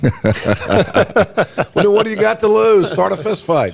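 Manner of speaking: words bubbling with real laughter; laughing. Real, not acted. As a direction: laugh through the joke, pitch jumping up. Laughing while joking about fights.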